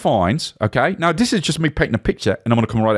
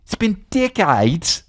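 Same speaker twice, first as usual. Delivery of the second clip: usual speaking voice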